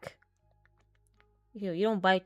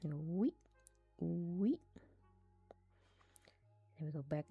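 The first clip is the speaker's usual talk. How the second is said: whispering; 9 dB quieter than usual. Real, not acted. Playful whisper while animating.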